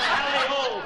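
high rallying holler; yelled. Acted. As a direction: sing out the cry to the dogs. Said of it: "snapped the whip and yelled".

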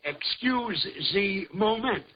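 very slow mock French accent; deliberate, stilted French accent; put-on French accent. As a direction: draw it out in fake French-accented English. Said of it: "Okay, I'll use an accent".

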